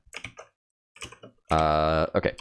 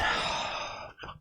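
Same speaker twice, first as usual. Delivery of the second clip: frustrated sigh; 8 dB quieter than usual. Real, not acted. Frustrated after failure.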